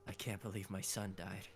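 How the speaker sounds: low, soft, grave dramatic delivery; mildly mournful actor's line read; serious acting. Imitating voice actor in a serious scene, grave. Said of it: "pretty serious".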